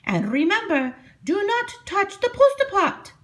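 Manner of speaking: put-on high old witch voice; Strega Nona voice. A narrator's reading. Strega Nona instructs her new helper, high old witch voice. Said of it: "Strega Nona said to Big Anthony".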